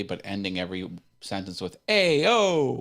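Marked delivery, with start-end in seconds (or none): 1.9-2.8 loud, high Hoboken holler; Hoboken accent. Acted. Mocking how Hoboken ends sentences, loud Hoboken accent. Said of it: "Hoboken just speaking normally".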